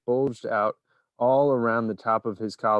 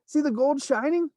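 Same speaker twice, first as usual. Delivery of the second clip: excited bystander. A narrator's reading. Bystander continues.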